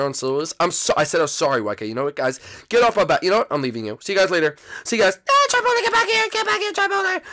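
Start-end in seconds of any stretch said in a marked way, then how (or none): 5.3-7.2 very high, loud squeaky calling; frantic sidekick. Acted. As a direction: squeal after someone who is leaving. Sidekicks call him back.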